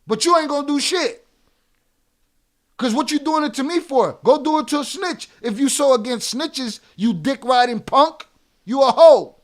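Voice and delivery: loud, heated shouting; furious rant. Real, not acted. Streamer yells at chatter calling him a hoe, furious. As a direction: yell him down. Streamer berating chat viewer.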